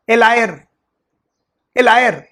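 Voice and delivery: teacher publicly mocking the student, loud; loud taunt. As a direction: jeer at the student in front of everyone. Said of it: "loudly before everybody".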